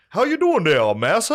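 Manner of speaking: high, loud put-on slave character voice; reenactor greeting. Retelling the reenactor's greeting, high and loud. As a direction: greet him in a high, hammed-up voice. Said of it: "And he's like".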